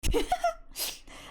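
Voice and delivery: breathy high laugh; high squeaky giggle. Real, not acted. Laughs at a comment.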